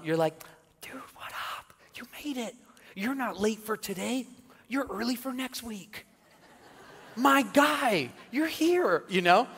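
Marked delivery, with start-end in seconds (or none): 0.8-1.7 breathless hush, friendly; whispering a welcome to a late churchgoer; whispered greeting. Pretend. Greeting a latecomer quietly. 1.9-9.0 cheerful welcome. Pretend. Welcoming a late churchgoer.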